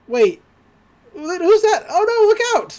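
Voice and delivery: high, loud acted alarm; alarmed warning. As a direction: cry out a warning in mock panic. Dipper spots the impostor.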